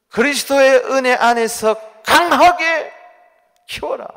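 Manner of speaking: solemn booming scripture quote; proclaimed scripture. Acted. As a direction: thunder the verse out to the congregation. Preacher proclaims scripture verse.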